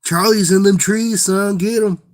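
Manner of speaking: high-pitched Kentucky hillbilly holler; mock Kentucky accent. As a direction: call it out in a put-on backwoods Kentucky twang. Continues mocking Kentucky people.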